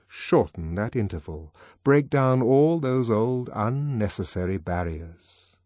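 usual speaking voice

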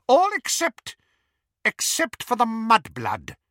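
high, loud, pointed order; sharp. A narrator's reading. Bellatrix singling out one prisoner, sharp. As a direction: pick out the victim sharply. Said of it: "said Bellatrix sharply".

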